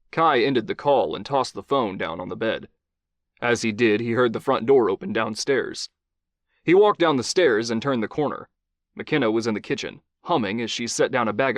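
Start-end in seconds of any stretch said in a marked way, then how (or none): none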